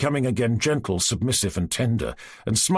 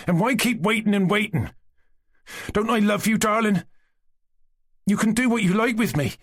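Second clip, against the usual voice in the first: impassioned and angry; 10 semitones higher than usual. A narrator's reading. Lukashka seizing the girl's hands, heated plea. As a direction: press her hard, frustrated and hungry. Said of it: "frowning angrily".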